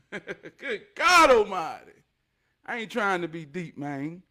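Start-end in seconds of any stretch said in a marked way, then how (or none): 0.1-2.0 slow, laughing "Good God Almighty"; laughing exclamation. Real, not acted. Laughing at how deep it gets.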